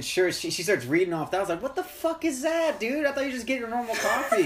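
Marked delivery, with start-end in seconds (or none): 1.6-4.5 high, incredulous mock protest; retold disbelief. Telling friend about protesting the strange Starbucks order, incredulous. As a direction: act out baffled objection, pitch jumping up.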